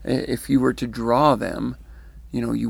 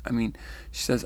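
ordinary talk, nothing marked

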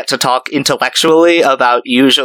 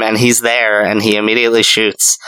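normal talking voice

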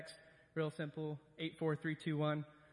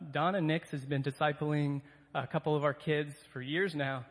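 ordinary talk

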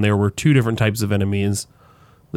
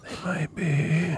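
slow, softer mysterious hint; hushed; 6 semitones higher, 9 dB quieter, much slower than usual. Pretend. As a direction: draw it out like a conspiracy. Conspiratorial joke continues.

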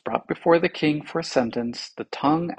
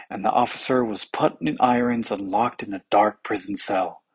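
ordinary delivery, nothing marked

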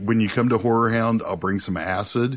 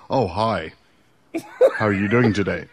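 accent dropped, mock British speaker. British person with mouth emptied, British accent suddenly gone. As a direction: clear American-accented greeting after a mock British one. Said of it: "they spit the balls out of their mouths".

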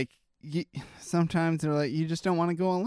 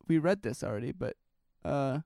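normal talking voice

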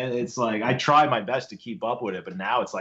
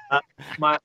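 usual speaking voice

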